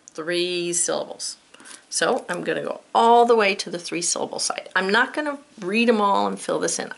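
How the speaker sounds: usual speaking voice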